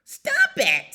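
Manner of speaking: high, loud bird screech; shrieked scolding order. A narrator's reading. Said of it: "screeched the bird".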